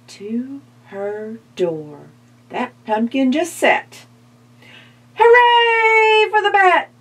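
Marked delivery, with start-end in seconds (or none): 5.1-6.9 high, loud cheering witch; shouting hooray. Acted. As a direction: cheer it out for the bat. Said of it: "shouted the witch".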